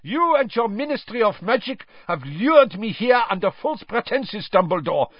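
put-on bellowed, high-pitched accusation with a foreign accent; furious accented tirade; Karkaroff. A narrator's reading. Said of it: "he bellowed, pointing at Dumbledore".

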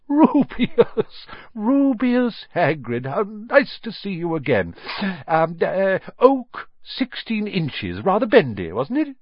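high, loud and gleeful; delighted greeting. Acted. Old wandmaker spots Hagrid, high and delighted.

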